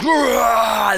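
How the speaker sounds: short booming roar like thunder; mock thunderous roar. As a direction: roar it out like a huge voice. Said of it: "his voice is like, like thunder".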